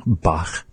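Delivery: Bach ending on a raspy back ch; German-style Bach; Bach with rasp. Shown as a demonstration. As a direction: breathe out the ch at the end of Bach. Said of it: "is the way that they say it".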